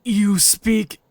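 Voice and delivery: a hint of a gruff alien dog-man; slow, heavy foreign-sounding voice; gruff alien husky. The husky speaking to the stranger, lightly gruff.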